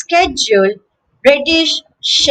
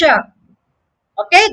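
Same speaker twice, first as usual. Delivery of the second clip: normal talking voice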